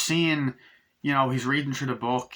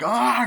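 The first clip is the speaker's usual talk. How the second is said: high, loud imitated yelp; mock scream; 11 semitones higher, 6 dB louder than usual. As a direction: cry out like a grabbed horror victim. Imitates film victim being attacked.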